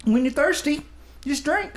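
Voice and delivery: mock folksy sage. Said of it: "I'm gonna close it out with this".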